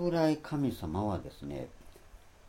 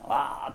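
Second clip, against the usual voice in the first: a raised, louder mock cheer; mock victory cheer; 5 semitones higher, 6 dB louder than usual. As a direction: whoop like a winner celebrating. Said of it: "とか言っていく".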